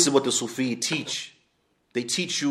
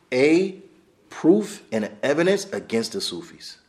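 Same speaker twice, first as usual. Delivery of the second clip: normal talking voice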